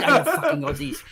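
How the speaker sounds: high-pitched mock French grumble; comic French accent, pitched high. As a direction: curse them as an exasperated Frenchman. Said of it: "a Frenchman, Thierry".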